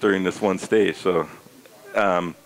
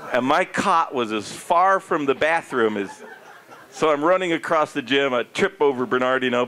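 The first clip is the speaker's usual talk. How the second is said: normal talking voice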